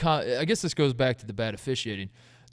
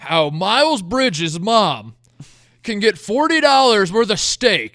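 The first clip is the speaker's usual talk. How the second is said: very slow, loud, high mock outrage; hammed-up ranting, every word stretched; fans losing their minds; 8 semitones higher, 9 dB louder, slower than usual. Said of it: "Purdue fans losing their minds".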